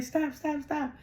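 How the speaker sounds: high-pitched mimicked pleas to stop; imitated protest. Acting out someone intervening at the table.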